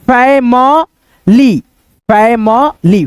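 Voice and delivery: "Family" slowly as fai-ma-lee, with gaps; broken into syllables. Shown as a demonstration. Teacher sounds out family for learners, slow syllables. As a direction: separate "family" into three long sounds. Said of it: "इसको क्या पढ़ेंगे".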